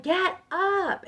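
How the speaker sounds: very high, strained-up mock scream; retold yell. Woman yelling out loud to break herself loose, retold yell. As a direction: push the pitch way up for a retold yell. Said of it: "yelling so loud".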